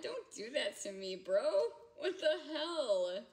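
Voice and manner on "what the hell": slow, high laughing outcry. Real, not acted. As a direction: cry it out through laughter. Reacting to snort, laughing.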